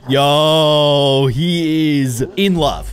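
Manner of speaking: loud, long-drawn amazed cry; thrilled. Real, not acted. Cook thrilled the customer loves his dish, thrilled. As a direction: stretch each word out in delight.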